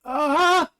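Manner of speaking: a high, loud, startled "Ah!"; mock frightened gasp; shocked gasp. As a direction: imitate a terrified cry of shock. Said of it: "it was a very shocked".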